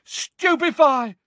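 high, drawn-out spell cry; loud, high-pitched incantation; shouted. A narrator's reading. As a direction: yell the spell at full pitch. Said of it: "Neville shouted again".

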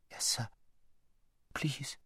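very soft, timid plea; meek girl. A narrator's reading. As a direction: answer humbly and quietly. Chastened girl begs to ask.

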